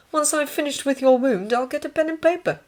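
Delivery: high-pitched, obliging orderly. Acted. Voices the orderly.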